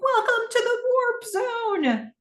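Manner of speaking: high, drawn-out triumphant call; excited cheer. Acted. Cam cheering the new screen with Gordon, excited. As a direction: stretch it out slowly in a high cheer. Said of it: "They're cheering".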